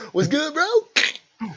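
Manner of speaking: high, slow drawn-out "what's good, bro"; mock tough guy. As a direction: stretch it out like a cocky bro cutting in.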